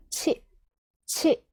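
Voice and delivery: "치" pronounced as one careful Japanese syllable; Japanese "chi" demo. Shown as a demonstration. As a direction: say "치" as a short, isolated Japanese chi. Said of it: "치 발음으로 발음하셔야 됩니다".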